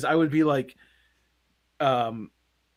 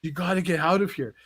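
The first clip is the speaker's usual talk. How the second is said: higher-pitched mock-urgent plea; mock warning; 5 semitones higher than usual. Concussed teen warns people that Venom is coming, mock urgent. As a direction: imitate a panicked warning, amused. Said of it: "I was trying to warn people".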